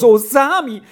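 aghast mother, voice leaping up; horrified exclamation. A narrator's reading. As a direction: cry out in shocked disbelief. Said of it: "rief die Mutter im höchsten Schrecken aus".

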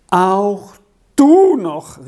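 loud, high exasperated outburst; growing agitation. A narrator's reading. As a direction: burst out, jumping up in pitch and volume. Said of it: "rief Castella in immer größerer Aufregung aus".

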